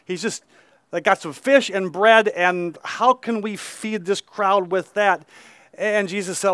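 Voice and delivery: usual speaking voice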